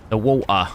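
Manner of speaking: The water with a dropped t, woʊə; The water said British style, no R, t swallowed. Shown as a demonstration. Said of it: "Look at that".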